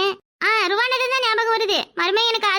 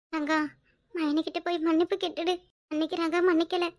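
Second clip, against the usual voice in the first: resigned murmur; 3 semitones lower, 8 dB quieter than usual. Acted. Resigned quiet advice.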